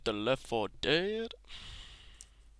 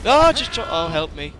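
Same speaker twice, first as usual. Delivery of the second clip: much higher and louder, calling for help; panicked yell; 5 semitones higher, 12 dB louder than usual. Real, not acted. Character falling, calls for help.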